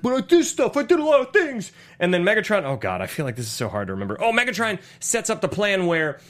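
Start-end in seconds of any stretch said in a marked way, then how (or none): 0.0-1.7 whiny Sam. Retold or imitated. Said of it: "And he's like".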